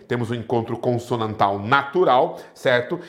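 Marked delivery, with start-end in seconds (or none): none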